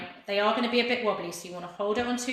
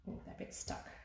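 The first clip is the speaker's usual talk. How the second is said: whispered aside; 16 dB quieter than usual. Real, not acted. Fiddling with skewers, muttered aside.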